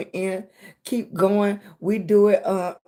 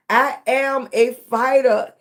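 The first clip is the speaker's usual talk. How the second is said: slow, firm and louder declaration; slow affirmation; 3 semitones higher, 4 dB louder than usual. Acted. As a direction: declare it like a vow. Chanted affirmation with the audience.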